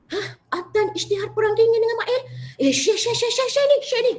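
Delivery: high-pitched, excited outburst over news; excited phone gossip. Acted. Young preacher reacting to news on the phone, excited and high. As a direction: gush it out high, rushing to share it.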